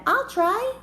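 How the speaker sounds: high, loud keen offer; eager volunteer. A narrator's reading. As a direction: call it out bravely. Badger volunteers to pull.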